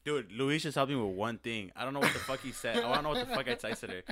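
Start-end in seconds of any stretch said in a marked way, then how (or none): none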